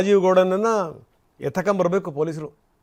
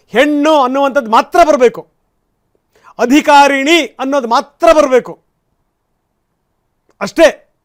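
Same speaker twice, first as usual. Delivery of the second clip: impassioned appeal; 3 semitones higher, 6 dB louder than usual. Real, not acted. Anchor's rising impassioned appeal.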